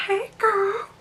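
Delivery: high, sing-song mock of a flirting guy; imitating Special K's cheesy phone greeting; flirty guy imitation. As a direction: ham up his cheesy pickup line. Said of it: "He's like".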